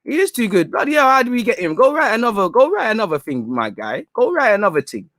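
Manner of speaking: high, sing-song fake agreement; mock gloating sarcasm. To pundit Danny Mills, a gloating sarcastic concession. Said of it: "'Cause I can't wait to say".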